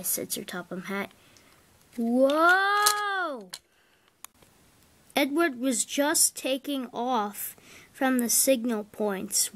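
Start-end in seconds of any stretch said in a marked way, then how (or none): 2.0-3.5 high, drawn-out cry of alarm; cartoon alarm as the car tips; long high whoa. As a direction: let the whoa rise and fall long. Car lurching again.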